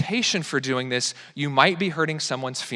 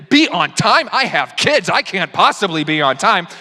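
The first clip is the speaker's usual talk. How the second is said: hammed-up protest, higher and louder; mock indignation; 4 semitones higher, 4 dB louder, slower than usual. Preacher mocking a parent who is always late, mock indignant. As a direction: whine it like an overwhelmed parent.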